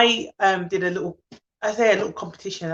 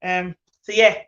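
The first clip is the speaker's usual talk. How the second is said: normal talking voice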